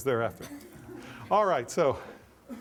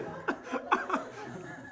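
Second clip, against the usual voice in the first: a short, high, breathy burst of laughter; 7 dB quieter than usual. Real, not acted. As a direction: laugh at your own rash yes. Laughs at own snap answer.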